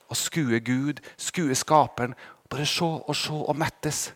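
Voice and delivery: hushed longing. Real, not acted.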